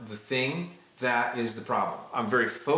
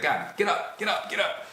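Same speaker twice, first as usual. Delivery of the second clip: higher, insistent mock urging; like rousing someone from a seat; urgent plea; 5 semitones higher than usual. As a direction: plead urgently, repeating it in quick bursts. Acting David urging God to rise.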